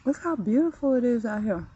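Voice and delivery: ordinary talk, nothing marked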